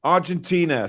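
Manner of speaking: ordinary talk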